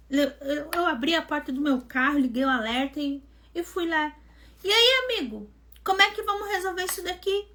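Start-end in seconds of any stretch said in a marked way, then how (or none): 4.6-7.5 pitched-up, sharp challenge to the driver; squaring up to the boy who hit her car; irritated confrontation. Retold or imitated. Angry woman confronting the driver who hit her car. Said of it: "eu braba, né?".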